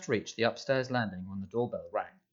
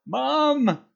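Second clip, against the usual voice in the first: much higher, loud panicked howl; howling cry; 10 dB louder than usual. A narrator's reading. Dudley fleeing to the house, howling for his mum. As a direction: wail for your mother in terror. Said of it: "howled Dudley".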